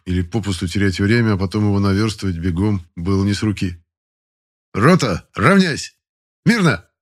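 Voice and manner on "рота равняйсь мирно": loud, high barked commands; drill command. Acted. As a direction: snap the company to attention. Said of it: "скомандовал ротный". (громкая строевая команда)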